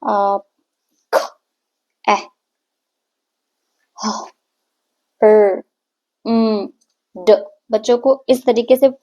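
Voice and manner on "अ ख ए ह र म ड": short isolated letter sounds a, kh, e, h, r, m, d; each letter said as its bare phonics sound. Shown as a demonstration. Teacher demonstrating letter sounds for kids, clipped sounds. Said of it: "जिसका sound इस तरीके से आता है".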